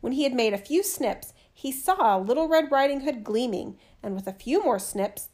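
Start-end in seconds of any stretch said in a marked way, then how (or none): none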